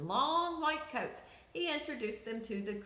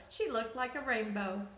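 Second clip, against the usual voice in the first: ordinary delivery, nothing marked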